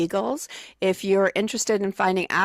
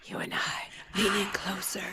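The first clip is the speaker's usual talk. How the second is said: mock dramatic whisper; breathy hammed-up whisper; 8 dB quieter than usual. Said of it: "us whisper now".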